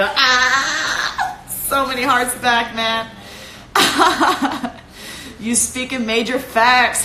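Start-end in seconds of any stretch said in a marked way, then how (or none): 0.0-3.1 joyful laughing cry. Real, not acted. Overjoyed at fans' comments. 3.2-5.3 high delighted laugh. Real, not acted. Laughing at fan comments.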